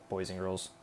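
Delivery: ordinary talk, nothing marked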